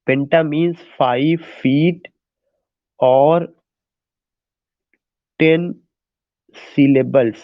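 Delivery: drawn-out words with long pauses; slow dictation. Real, not acted. Teacher dictating what penta means, slow dictation. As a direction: dictate word by word.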